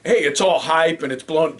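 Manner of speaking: ordinary delivery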